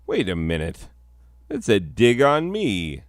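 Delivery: normal talking voice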